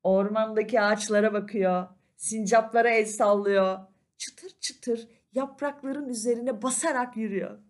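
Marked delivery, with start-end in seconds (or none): none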